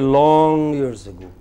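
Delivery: "Long" drawn out and prominent; "Long" held long, slow phrase; stress on Long. Shown as a demonstration. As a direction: stretch the word "Long", then let "years ago" fall away. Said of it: "The long is prominent".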